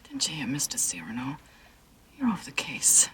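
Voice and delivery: tense hushed whisper. Acted. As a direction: brush him off in a whisper. Evasive hushed reply.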